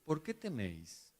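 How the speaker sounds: very soft, calm question; gentle calm. Pretend. Jesus gently asking his frightened disciples. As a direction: reassure them softly. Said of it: "Pero a Jesús me lo imagino".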